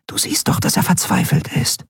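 high, half-whispered little voice; small hushed character voice; urging friend. Acted. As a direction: urge her in a high hushed voice.

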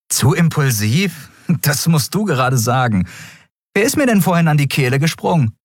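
incredulous, turning the charge back on him; indignant retort. Acted. Captain throwing Kero's words back at him, indignant.

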